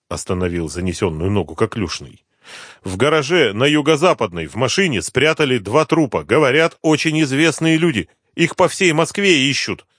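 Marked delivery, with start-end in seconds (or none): 2.8-9.8 higher-pitched voice of a pleading snitch; anxious addict informant. Acted. Addict tells the inspector about hidden bodies, high insistent voice. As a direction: play the informant eager to be believed.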